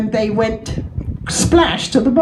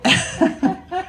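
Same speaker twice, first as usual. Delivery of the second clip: laugh; 8 semitones higher, 4 dB quieter than usual. Real, not acted. Laughing at own joke.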